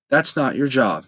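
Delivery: usual speaking voice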